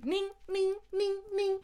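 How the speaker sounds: Ning squeaked high; just the ending. Shown as a demonstration. Mocking the shortened greeting as high ning. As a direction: chirp Ning, ning high and quick. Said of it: "retenir la fin".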